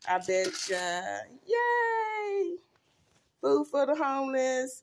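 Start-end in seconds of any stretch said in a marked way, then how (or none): 1.5-4.8 squealed high yay; high cheer. Real, not acted. Vlogger cheering to followers. Cheering about food for homeless.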